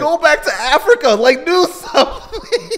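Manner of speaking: high, loud playful urging; mock exasperated holler. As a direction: plead loudly, half laughing. Mock pleading with parents.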